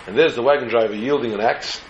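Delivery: usual speaking voice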